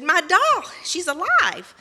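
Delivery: loud, high exclamation; amazed cry. Retold or imitated. Girl rushing downstairs to a moving doll, amazed. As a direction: cry out in acted astonishment.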